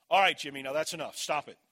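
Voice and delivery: stern father laying down the law; very firm. Retold or imitated. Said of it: "but I said it very firmly".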